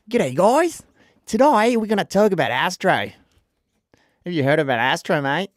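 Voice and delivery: hammed-up Australian presenter; mock Australian accent. Host hamming up an Australian accent for viewers. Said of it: "my accent gets so much".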